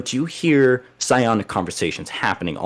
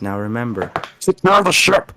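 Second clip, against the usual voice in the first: strange changed voice; 3 semitones higher, 4 dB louder than usual. Acted. In the dream the grandmother's voice changes as she orders, loud and strange. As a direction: shout the warning in an alien voice. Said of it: "her voice changes, and it sounds nothing like her".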